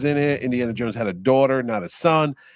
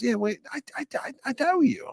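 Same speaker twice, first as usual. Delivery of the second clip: much higher, stammering I know you; sure recognition; 12 semitones higher, 5 dB quieter than usual. Retold or imitated. Lincoln placing the host's face, high and stammering.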